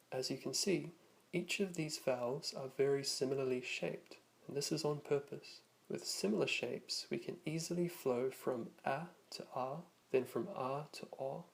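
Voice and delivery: usual speaking voice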